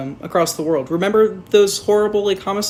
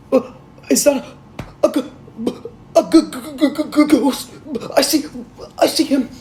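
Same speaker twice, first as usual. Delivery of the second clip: higher, stammering fake fright; mock scared stammer; 9 semitones higher, 4 dB louder than usual. Reviewer pretends to fear a ghost, higher stammer. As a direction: stutter in hammed-up fear.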